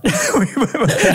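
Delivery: surprised high laugh bursts out; high burst of laughter. Real, not acted. Host recalling a shock result, high laugh.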